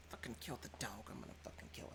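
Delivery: whispered furious threat; muttered fury. Pretend. Susanna stomping away, whispering murderous fury. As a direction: hiss the threat under your breath. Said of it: "Susanna goes off and starts stomping".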